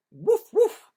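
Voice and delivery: high, loud barks; dog barking. Acted. Storyteller barking like a dog. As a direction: yap like a dog.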